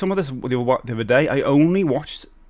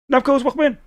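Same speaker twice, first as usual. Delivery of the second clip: pitched-up welcome call; high-pitched greeting; 13 semitones higher, faster than usual. Acted. Streamer welcoming viewers in, high-pitched. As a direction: chirp a high welcome to someone arriving.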